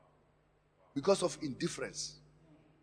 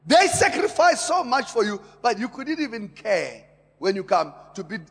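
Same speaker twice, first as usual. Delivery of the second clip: loud, high appeal; forceful preaching; 4 semitones higher, 9 dB louder than usual. Acted. Pastor pleading about family sacrifices, loud and high. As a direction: plead hard with the listeners.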